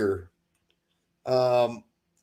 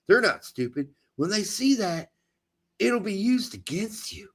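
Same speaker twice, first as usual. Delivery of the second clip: ordinary delivery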